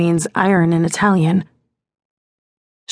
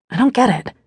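ordinary delivery, nothing marked

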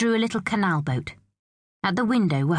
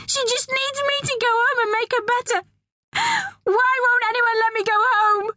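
put-on very high child's wail, building louder; desperate shouting; 3 dB louder than usual. A narrator's reading. Pearl begging to go home to mum, desperate shouting. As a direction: plead at a screaming pitch. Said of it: "shouted Pearl".

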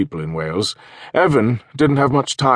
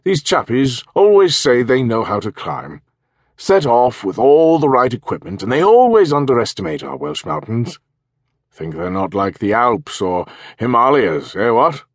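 throaty posh English major, raised and loud; upper-class English; 4 semitones higher, 5 dB louder than usual. Acted. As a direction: play a pompous retired English officer. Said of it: "in a throaty, upper-class English voice".

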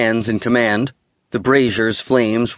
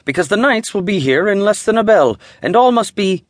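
much higher, fussy commanding voice; 9 semitones higher, faster than usual. A narrator's reading. Steward ordering his doorjacks to hurry, much higher and commanding. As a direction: rush the doorjacks along from on high. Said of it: "he commanded".

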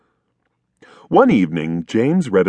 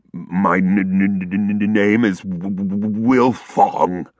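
stammering from cold; shivering stutter. A narrator's reading. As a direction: stutter through the chattering. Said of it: "said the wolf as he shivered and shook".

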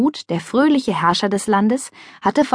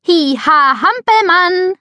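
high, louder silly jumping-jack call; playful shout; 10 semitones higher, 5 dB louder, slower than usual. A narrator's reading. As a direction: ham it up mid-jump. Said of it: "rief Mia".

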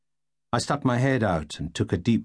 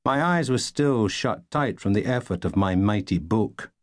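usual speaking voice

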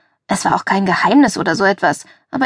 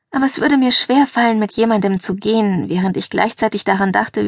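ordinary delivery

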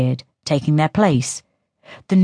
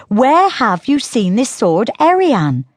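put-on higher, light teasing girl's voice; girl Synth voice; 7 semitones higher than usual. A narrator's reading. Sister taunting Diello about his tale, in a higher teasing voice. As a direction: taunt him as the younger sister.

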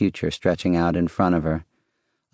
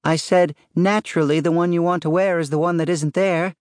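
pitched-up, projected repeat; raised voice; 11 semitones higher than usual. A narrator's reading. Wife repeats her remark to husband upstairs, raised voice. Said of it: "Lucille raised her voice".

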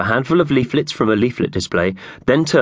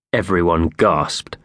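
usual speaking voice

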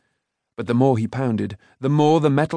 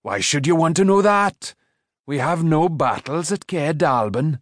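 higher-pitched remonstrance; scolding; 5 semitones higher than usual. A narrator's reading. Smith dismissing talk of sword fighting, scolding. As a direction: challenge him in the smith's voice.

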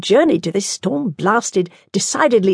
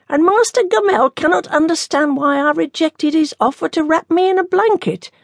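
nun voice; 10 semitones higher than usual. Acted.

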